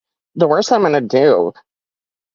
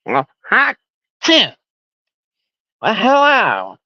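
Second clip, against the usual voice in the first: higher, congested mock greeting; nasally goblin impression; 6 semitones higher than usual. Streamer being taken over by the goblin, nasally impression. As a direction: put on a stuffed-up goblin voice. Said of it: "that fits his nasally congestion".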